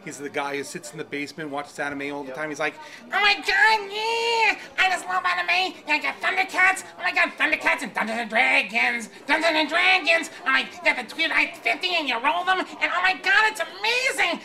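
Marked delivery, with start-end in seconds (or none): 3.0-14.4 high, loud, gushing nerd shout; fat otaku voice. Acted. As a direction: squeal like an obsessed anime fan. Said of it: "I call him the fat otaku".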